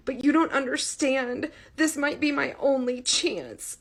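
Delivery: high, pleading voice near tears; close to tears. A narrator's reading. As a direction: beg as if about to cry. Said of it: "She sounded close to tears".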